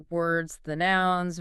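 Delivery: ordinary talk, nothing marked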